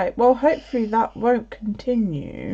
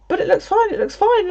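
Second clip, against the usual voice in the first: mock insistence; 11 semitones higher than usual. Boyfriend insists stream fine.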